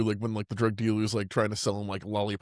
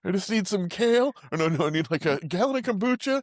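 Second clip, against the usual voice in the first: high mocking voice; 9 semitones higher than usual. Mocking a health nut.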